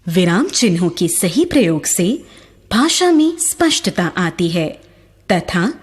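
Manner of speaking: ordinary talk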